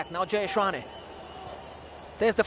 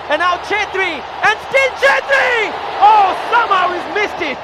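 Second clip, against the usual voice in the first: climbing high and loud, then groan; rising excitement; 7 semitones higher, 6 dB louder than usual. Real, not acted. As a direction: build to the chance, then dismay. Near miss called live.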